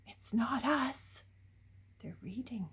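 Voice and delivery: soft whispered aside. Real, not acted. Reader pointing out the boys to the child, whispered. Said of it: "aside to the listener".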